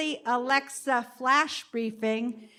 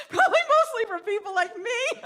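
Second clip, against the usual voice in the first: laughing; 10 semitones higher than usual. Real, not acted. Joking about fans, laughing.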